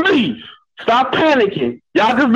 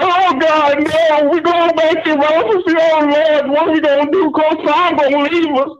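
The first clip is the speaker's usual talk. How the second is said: higher-pitched mock wailing over a loss; mock mourning; 4 semitones higher, slower than usual. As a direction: lament as if somebody died, hammed-up. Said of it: "like somebody died".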